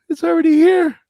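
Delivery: high, put-on conspiracy nut voice; hammed-up paranoid rant; mock paranoid voice. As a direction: ham up the paranoid guy showing his chip.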